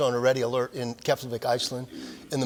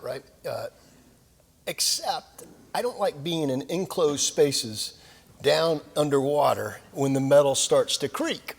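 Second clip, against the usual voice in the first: ordinary talk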